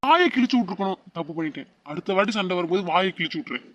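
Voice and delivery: quick hushed aside; muttered aside. Acted. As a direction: mutter it under your breath. Husband mutters aside by hospital bed.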